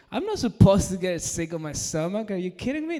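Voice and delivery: loud, high-pitched incredulous protest; mock indignation. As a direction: protest in disbelief, pitch jumping up. Indignant at getting sick.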